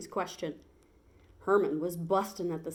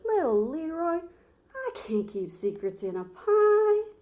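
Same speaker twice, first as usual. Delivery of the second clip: lightly voiced cowboy Sam; 9 semitones higher, 6 dB louder than usual. Sam answers Leroy in character.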